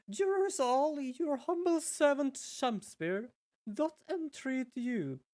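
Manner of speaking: grandly entreat the jurors; voiced Shamspear. Game witness pleading to the jury, high and theatrical.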